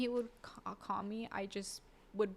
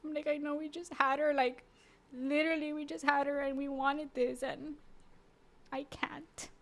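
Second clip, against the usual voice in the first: higher, pleading, near tears; retold begging; 6 semitones higher, 3 dB louder than usual. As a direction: beg him not to leave, voice climbing up. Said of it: "begging him".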